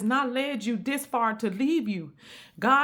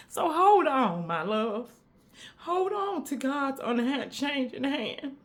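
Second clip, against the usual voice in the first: slow preacher's plea; 3 semitones higher, slower than usual. Acted. Closing exhortation.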